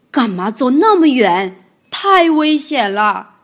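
响亮的责骂，"太危险了"放慢; 又急又气地训孩子; 生气地大叫. Acted. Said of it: "霸王龙生气地大声叫着". (霸王龙生气地大声责备)

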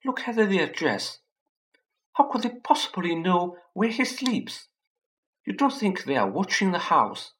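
high, trembling worried voice; quivering voice. A narrator's reading. Petunia frets to Vernon, high and quivering. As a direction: fret in a shaky high voice. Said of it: "in a quivering voice".

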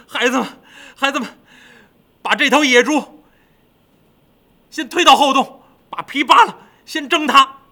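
high, loud, exasperated orders; loud, high, fuming king; angry demon king. Acted. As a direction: order the minions about, tearful and fed up. Fed-up king orders Pig steamed.

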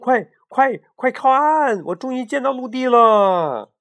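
high, loud urgent joy; excited shout. Acted. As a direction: shout for the boat to land. Chick spots land.

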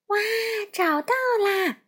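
put-on high delighted child's cry; joyful shout. A narrator's reading. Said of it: "佩佩高兴地又跳又喊". (高兴地喊)

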